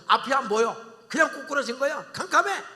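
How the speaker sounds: shouting that it's all dark; dramatic alarm. Acted. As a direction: build panic as the lights go out. Preacher acts sudden blindness.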